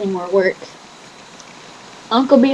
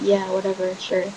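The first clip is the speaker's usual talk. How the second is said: dull mumble; 8 dB quieter than usual. Retold or imitated. Depressed dismissive reply.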